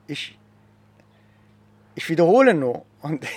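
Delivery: usual speaking voice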